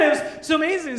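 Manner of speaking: normal talking voice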